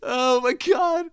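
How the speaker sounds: slow, high, laughing through the words. Real, not acted. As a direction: still giggling at yourself. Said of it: "That was so terrible".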